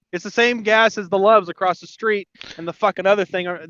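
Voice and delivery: normal talking voice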